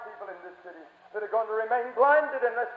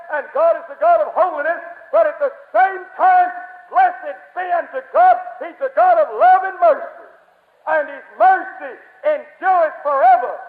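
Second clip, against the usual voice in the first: building, loud, raised proclamation; full-force preaching; 4 semitones higher, 8 dB louder than usual. Real, not acted. Preacher proclaims God's wrath and mercy, loud and rising. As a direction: swell from firm warning to ringing praise.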